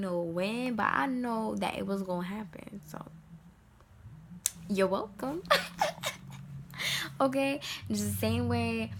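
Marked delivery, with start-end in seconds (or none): 5.5-7.1 a bright breathy laugh; high airy laugh. Real, not acted. Vlogger laughing at her own joke, high airy laugh.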